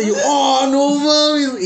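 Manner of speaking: high, loud, very slow crying shout; pained cry. Retold or imitated. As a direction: cry out as the arm gives way. Pain when pushing up broken arm.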